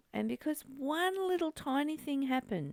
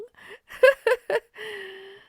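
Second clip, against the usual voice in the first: high burst of laughter; real high laugh; loud laugh; 10 semitones higher, 7 dB louder than usual. Real, not acted. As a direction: laugh out at the mock quote. Laughs after mock quote.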